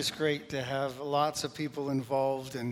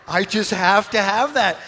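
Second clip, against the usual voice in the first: higher and much louder mock plea; hammed-up craving; 5 semitones higher, 9 dB louder than usual. Pastor retelling how he begged Kev for the hat, hammed-up and loud. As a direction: belt out the craving like a kid begging for a toy. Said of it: "and I'm like".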